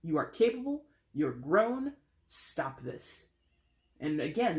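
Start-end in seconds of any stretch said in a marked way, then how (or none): none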